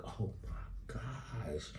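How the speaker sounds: very slow, whispered mock horror; hushed shock. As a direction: breathe it out long and stunned. Reacts to driver's meltdown.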